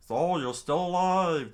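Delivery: high, loud, stretched challenge; taunting henchman. Acted. Henchman confronting the man who struck his king, loud taunt. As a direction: call out the returning foe.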